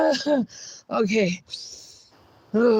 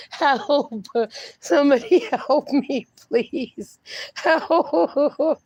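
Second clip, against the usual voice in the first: laughing plea for help; slower than usual. Real, not acted. Streamer laughing so hard she begs chat for help. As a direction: gasp out help between giggles.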